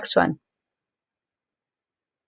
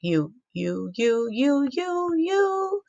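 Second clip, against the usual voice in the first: playful climbing repeats; 6 semitones higher, 4 dB quieter, slower than usual. Shown as a demonstration. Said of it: "I did it here".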